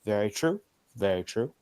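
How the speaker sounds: murmured agreement. Acted. Character agreeing, low murmur.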